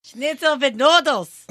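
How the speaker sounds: slow, hammed-up German accent; mock German, drawn out; a silly put-on German accent. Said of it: "you didn't do the German accent".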